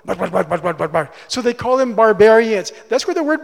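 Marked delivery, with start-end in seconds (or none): 0.0-1.1 low mock-barbarian babble; low, monotone string of gibberish syllables; imitating barbarian speech. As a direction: imitate incomprehensible foreign talk, pitched down low.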